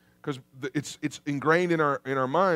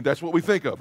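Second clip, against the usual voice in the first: ordinary delivery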